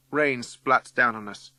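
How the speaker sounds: plain reading voice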